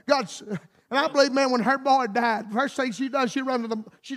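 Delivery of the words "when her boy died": ordinary delivery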